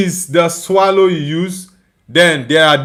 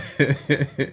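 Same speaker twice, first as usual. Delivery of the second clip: laugh; 4 semitones lower, 10 dB quieter than usual. Real, not acted. Laughing at a substitution.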